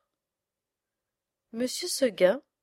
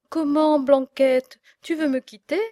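higher and louder, hurt disbelief; dismayed farmer; 4 semitones higher, 6 dB louder than usual. A narrator's reading. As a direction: ask as if you cannot believe it. Farmer pleads with goat. (le fermier incrédule, la voix qui monte)

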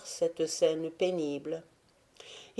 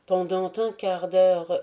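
usual speaking voice

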